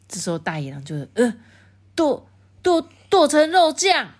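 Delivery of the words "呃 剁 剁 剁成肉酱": high, loud stuttering wolf; high, loud frightened stutter; shocked stammer. Acted. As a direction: stammer in sudden alarm. Wolf shocked by hunter story.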